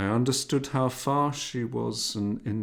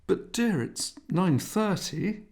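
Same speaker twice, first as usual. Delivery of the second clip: mother's voice; 11 semitones higher, 4 dB louder than usual. A narrator's reading. Mother gently chiding son.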